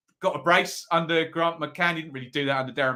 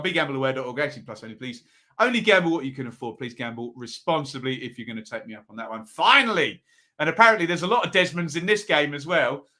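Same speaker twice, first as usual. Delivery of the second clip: usual speaking voice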